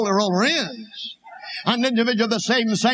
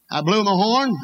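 ordinary delivery

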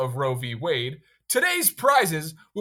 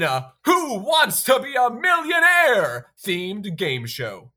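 game show host; 5 dB louder than usual. Acted.

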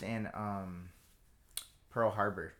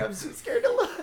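much higher hammed-up fearful voice; mock scared; 6 dB louder than usual. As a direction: squeak it out in put-on fright. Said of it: "I was like".

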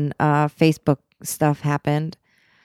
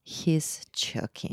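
whispered aside; 8 dB quieter, much slower than usual. Acted. Aside to listeners.

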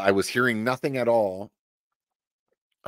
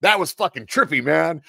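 higher, loud laid-back movie line; quoting Pulp Fiction; 6 semitones higher, 5 dB louder than usual. Retold or imitated. He jokes to his buddy after the strike, quoting the movie. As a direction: play it cool like the film character. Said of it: "I'm quoting the movie".

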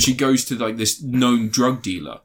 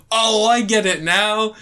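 slow dim realisation; 9 semitones higher, slower than usual. Retold or imitated. Retelling comedy scene, character voice.

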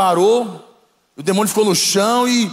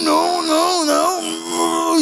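high crying shriek, much higher, in panic; desperate demon scream; 11 semitones higher, much slower than usual. Retold or imitated. Demon refusing the blood of Jesus, crying desperate shriek. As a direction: scream it like a tormented demon begging. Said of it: "E desesperou".

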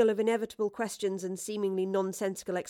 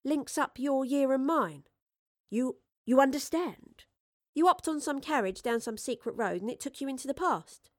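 put-on cheeky street girl; 5 semitones higher than usual. A narrator's reading.

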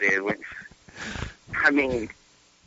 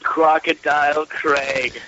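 slow, drawn-out Crocodile Craig in a lightly put-on Australian accent; mock Australian drawl; slower than usual. Shown as a demonstration. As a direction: stretch out Crocodile Craig like a hammed-up Aussie. Echoes name in character voice.

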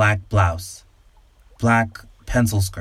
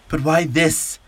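desperate plea; 7 semitones higher, slower than usual. A narrator's reading. The man pleads in horror.

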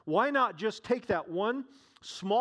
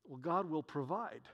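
quiet trust; 3 semitones lower, 7 dB quieter than usual. Acted. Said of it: "The woman says".

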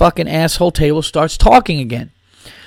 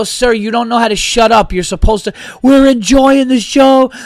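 exasperated; 6 semitones higher than usual. Retold or imitated. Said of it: "I go".

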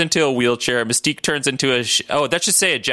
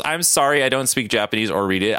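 ordinary delivery

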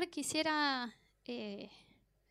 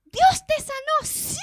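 very high, loud re-enacted cry of amazement; overcome, tearful exclamation; 13 semitones higher, 11 dB louder than usual. Preacher reliving crying with the healed patient, high overwhelmed shout. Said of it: "yo empecé a llorar y decía".

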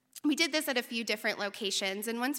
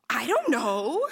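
louder, mock-alarmed admission; wide-eyed colleague; 5 dB louder, slower than usual. Colleague admits he has no idea about venom, mock wide-eyed alarm. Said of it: "his eyes get wide and he goes".

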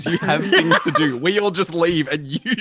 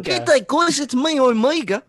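put-on higher, bright kid voice; Omega impression; 11 semitones higher, 4 dB louder than usual. Friend playfully voicing a young girl, high-pitched. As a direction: chirp it like an eager kid. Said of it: "Should read it like Omega".

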